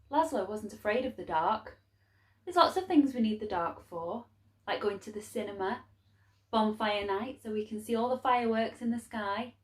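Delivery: plain reading voice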